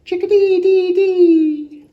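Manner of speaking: high, loud bird call; sing-song bird call; chickadee call. Acted. Reader voices chickadee call.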